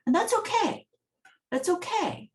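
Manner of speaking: high-pitched, falsely breezy reassurance; mock acceptance. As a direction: pretend it's perfectly fine, voice sailing up. Quoted colleague feigning acceptance of exclusion.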